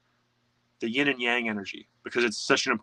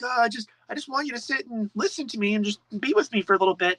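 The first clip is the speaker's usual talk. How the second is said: put-on high, pleading child's voice of the inner boy; the shadow answers as a small neglected boy, high and plaintive; little boy voice; 11 semitones higher than usual. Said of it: "that part of you is like".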